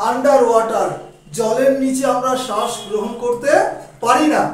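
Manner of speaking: ordinary talk, nothing marked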